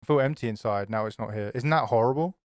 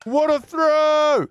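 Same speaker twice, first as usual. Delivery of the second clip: slow, stretched amazed cry again, higher; amazed shout; 12 semitones higher, 6 dB louder, much slower than usual. Real, not acted. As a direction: call it out long and high in awe. Repeats his amazement.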